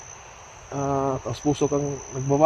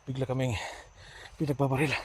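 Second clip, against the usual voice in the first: hushed; 13 dB quieter than usual. Real, not acted. Ambushed under fire, hiding.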